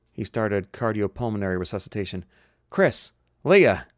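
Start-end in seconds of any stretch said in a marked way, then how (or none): 2.7-3.9 high, loud call for help; yelling. Acted. Doctor calls helpers during resuscitation, yelling. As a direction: yell names across a fight. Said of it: "he yelled".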